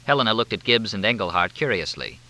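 plain reading voice

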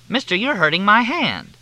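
put-on high, loud indignant old woman; old lady complaint. A narrator's reading. Leona Hatch protesting her hand is squeezed.

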